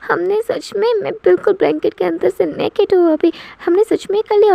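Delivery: realising it all at once, freaking out; panicking. A narrator's reading. Girl realises the night before to Teju, panicking. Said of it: "बिल्कुल panic हो जाती है".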